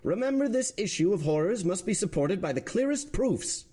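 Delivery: stern president. Acted. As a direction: presses the court like a presiding judge. President addressing the court.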